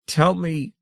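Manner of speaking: high, pleading tell me; begging. A narrator's reading. Saren begs the grandmother to tell, high and pleading. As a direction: beg her desperately. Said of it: "Saren was ashen and hysterical".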